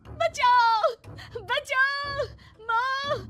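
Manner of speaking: loud, high terrified screams; screaming for help. Acted. Priya calling mother-in-law away from the ghost, screaming. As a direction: shriek desperately to be rescued.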